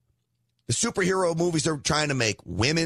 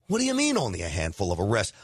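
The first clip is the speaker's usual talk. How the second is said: fast, lower, scoffing disbelief with a rise on "mean"; incredulous echo; 7 semitones lower, faster than usual. Real, not acted. Host scoffing at the arrest count, fast disbelief. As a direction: throw it back fast like you can't believe it.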